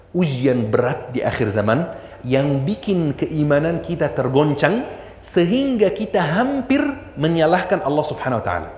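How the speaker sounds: ordinary talk, nothing marked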